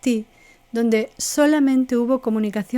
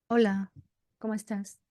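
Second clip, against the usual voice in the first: glum greeting; 6 dB quieter than usual. Shown as a demonstration. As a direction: greet them like you have no energy. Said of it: "la distinción entre lo que digo y cómo lo digo".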